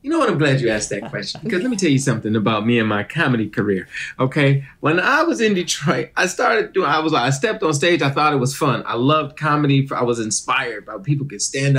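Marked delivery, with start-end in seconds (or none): none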